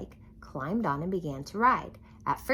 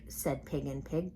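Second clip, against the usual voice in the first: usual speaking voice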